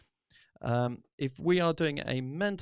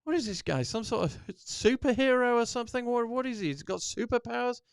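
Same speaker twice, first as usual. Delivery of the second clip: higher, mock incredulous questioning; imitating disbelief; 7 semitones higher than usual. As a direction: play a doubter asking in disbelief. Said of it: "And they'll say".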